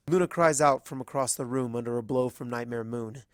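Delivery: ordinary delivery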